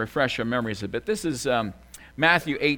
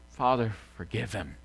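normal talking voice

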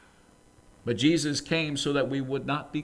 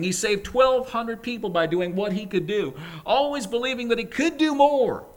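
building, higher and louder insistence; emphatic preaching; 8 semitones higher, 5 dB louder than usual. Acted. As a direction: drive the point home to the congregation. Emphatic sermon climax.

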